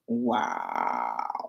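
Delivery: a low, put-on wow; mock deep wow. Vlogger reacting with a goofy low wow.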